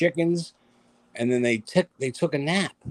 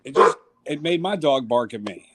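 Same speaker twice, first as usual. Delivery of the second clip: ordinary delivery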